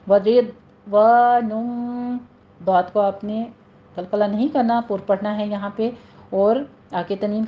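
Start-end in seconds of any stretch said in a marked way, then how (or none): 0.1-2.2 وَرِضْوَانٌ slow, with a clear w and nasal ending; tajweed recitation. Shown as a demonstration. Teacher recites the word as taught, slow chant. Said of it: "واو کی sound آئے گی".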